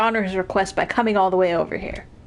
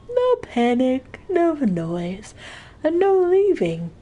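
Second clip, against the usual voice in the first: slow, higher, deliberate caretaker voice; handyman character voice; 6 semitones higher, slower than usual. Acted. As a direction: lay down the rules slowly.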